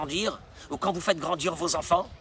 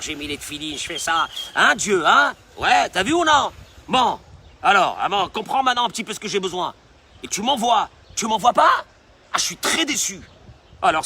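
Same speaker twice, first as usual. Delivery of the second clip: louder, demanding griping at God; a worshipper scolding God he owes him; indignant complaint to God; 6 dB louder than usual. Acted.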